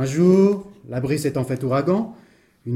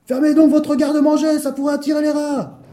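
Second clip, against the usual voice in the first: high, loud rude shout; furious yelling; 13 semitones higher, 5 dB louder than usual. Acted. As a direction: shout them down in a rage. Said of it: "parut frapper de folie furieuse".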